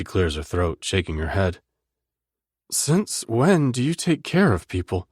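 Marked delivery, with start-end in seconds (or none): 2.7-5.1 female voice. Acted. Heroine disbelieving question.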